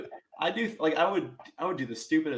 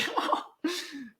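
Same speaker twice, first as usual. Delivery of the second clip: high laugh. Real, not acted. Laughing at being bitten.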